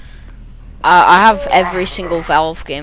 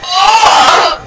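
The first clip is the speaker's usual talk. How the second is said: high frantic cries while fleeing; panicked yelling; 7 semitones higher, slower than usual. Real, not acted. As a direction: panic as the killer grabs you. Chased by killer in game.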